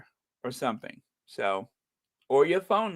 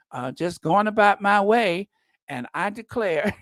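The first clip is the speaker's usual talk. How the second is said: Southern belle. Pretend. Mocking the senator as a fainting Southern belle. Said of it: "a Southern belle".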